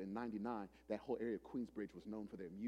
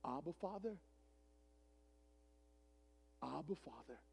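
hushed, pleading prayer; praying in the Spirit; 3 semitones higher, 5 dB quieter than usual. Retold or imitated. Pastor praying inwardly before facing Godfather, hushed and reverent. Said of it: "In the spirit, I said".

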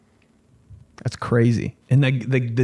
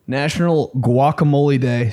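ordinary delivery

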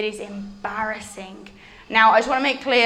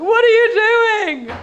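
crowd asking; 11 semitones higher, 8 dB louder than usual. Acted. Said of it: "They're all like".

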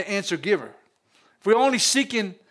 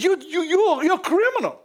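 higher, pointed scolding of the other thief; indignant rebuke; 6 semitones higher than usual. Retold or imitated. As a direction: jab each "you" at him, voice raised. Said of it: "He said".

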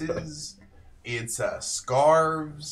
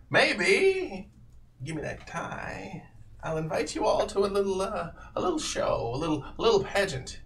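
higher-pitched, coaxing lilt; coaxing stranger; 7 semitones higher than usual. Acted. Lantern man invites the party to his show, coaxing. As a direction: dangle an offer, pitched up.

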